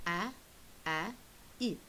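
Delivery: "a, a, i" as three short separate vowel sounds; isolated vowel sounds. Shown as a demonstration. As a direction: sound out "a, a, i" with pauses between. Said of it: "three vowel sounds".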